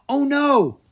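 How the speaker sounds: high, loud frightened cry; boy startled by attacking villagers; alarmed gasp. Acted. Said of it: "Drake gasped".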